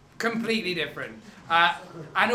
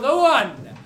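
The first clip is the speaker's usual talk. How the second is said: slow, loud, drawn-out showman intro; grand announcement; 7 semitones higher, 9 dB louder, much slower than usual. Acted. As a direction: announce it like a big act. Host announcing the act.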